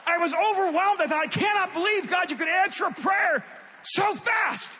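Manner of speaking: high, loud cry of amazement; stunned exclaiming; overwhelmed. Real, not acted. Overwhelmed by sudden healing.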